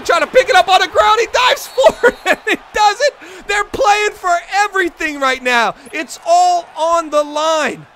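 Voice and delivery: high, loud, excited sportscaster call; full-throttle commentary at a game's climax; climactic play-by-play. Acted. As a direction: belt out the big play, stretching the final phrases.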